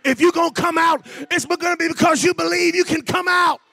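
loud, driving exhortation; full-force preaching. Real, not acted. Preacher urging the congregation to speak for themselves, full-force preaching. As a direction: shout it out in punchy preacher phrases.

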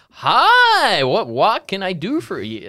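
loud, squealing-high greeting, then easing down; hammed-up customer service gush; over-friendly shop worker. American shop staff working for tips, over-friendly high voice. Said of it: "So they're really like".

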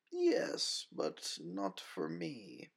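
low, slow woman with a hint of a Russian accent; deep Russian-accented woman. A narrator's reading. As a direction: give it a faint Russian lilt, unhurried. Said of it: "It was a deep female voice, with just a hint of a Russian accent".

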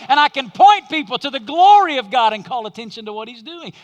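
rising, loud preaching toward a climax; building preacher's cry. Acted. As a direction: preach it harder line by line. Preaching builds to full force.

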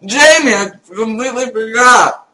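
sudden loud realization; surprised shout. Real, not acted. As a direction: blurt it out loud.

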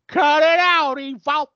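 high, hammed-up whiny protest; mock whiny Amy. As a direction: whine it like a scared girlfriend. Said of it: "Amy's like".

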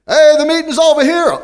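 loud, high call across a parking lot; old timer yelling. Retold or imitated. Old timer shouts to the man hiding his motorcycle, loud. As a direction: holler it out to the shadows. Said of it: "Do not yell at an alcoholic".

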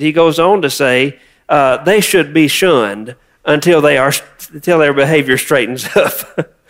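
usual speaking voice

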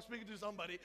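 normal talking voice

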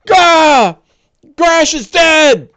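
full-force, high-pitched panicked shout; screaming in alarm. Acted. Game master as an attacked orc, screaming for help. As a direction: scream the alarm at the top of your range. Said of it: "he's screaming out".

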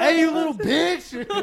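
high, loud, stretched-out jeer; laughing, hammed-up gloat; mock taunt. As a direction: holler it as a joking insult.

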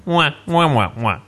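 muted trombone wah wah; Charlie Brown teacher wah. Retold or imitated. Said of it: "the thing out of Charlie Brown".

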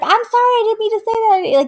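fast, high-pitched mock pleading; imitated panicked apology; frantic apology. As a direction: blurt it out high and fast, backtracking. Friend frantically apologizing to angry mom.

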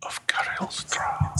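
slow breathy stage whisper; whispered aside. Real, not acted. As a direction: lean in and whisper it. Whispered aside at segment intro.

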